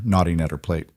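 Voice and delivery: usual speaking voice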